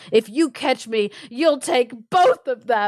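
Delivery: mildly dramatic shout-back; mock cry. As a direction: call back while fleeing, half-hearted. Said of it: "cries out".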